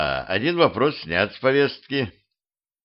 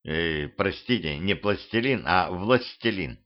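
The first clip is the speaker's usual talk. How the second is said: softer, polite correction; quiet correction; 4 semitones lower, 6 dB quieter than usual. Acted. Narrator politely corrects spider.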